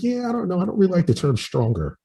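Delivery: high, airy mock woman's voice, lightly done; mimicking the woman. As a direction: squeak it out like a flustered girl. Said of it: "She's like".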